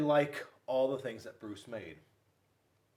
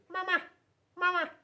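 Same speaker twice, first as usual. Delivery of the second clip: very high, chirpy gosling calling Mama; baby goose voice; 4 dB louder, faster than usual. Acted. Goslings calling after grumpy bear Bruce, high and chirpy. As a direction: pipe it up like a tiny gosling calling its mother. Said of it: "and said".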